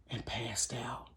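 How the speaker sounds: hushed whisper. Retold or imitated. Storyteller whispers the boy fainting again. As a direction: drop it to a breath.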